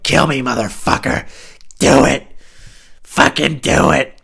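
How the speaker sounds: loud, slow dare to shoot; retold drunk defiance, yelled; defiant yell. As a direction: shout it daring him to pull the trigger. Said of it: "And I was serious".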